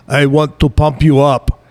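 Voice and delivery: imitating a Hungarian accent. Host mimics guest's accent for a catchphrase, Hungarian accent. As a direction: put on a mock Hungarian accent. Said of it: "in his Hungarian accent say".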